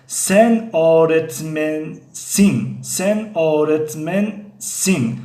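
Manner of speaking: Ben öğretmenim spoken very slowly, Ben stressed; slow model sentence. Shown as a demonstration. Teacher modelling Ben öğretmenim slowly for the class. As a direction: drill it slowly for students to repeat. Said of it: "Turkish model sentence for learners".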